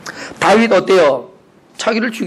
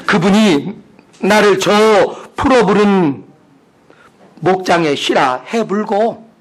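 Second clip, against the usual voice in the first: hammed-up Jeolla accent, phrases stretched out; Jeolla dialect; 6 semitones higher, 3 dB louder, slower than usual. Shown as a demonstration. Preacher retelling Psalm twenty-three for laughs, Jeolla dialect. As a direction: play up the Jeolla drawl for comedy. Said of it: "또 전라도 버전 또 해야 되잖아요".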